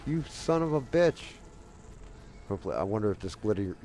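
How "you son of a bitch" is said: high-pitched, frustrated outburst; real indignant swearing; exasperated cursing. Real, not acted. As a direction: curse at what just went wrong. Cursing at game mishap.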